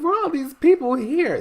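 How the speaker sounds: high squeaky disbelief at the crowd; surprised squeak. Retold or imitated. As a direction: squeak it like you can't believe the crowd. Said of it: "was like".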